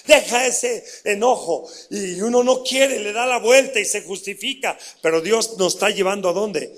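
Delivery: fiery pulpit preaching; forceful preaching. Acted. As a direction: drive each deja home to the congregation.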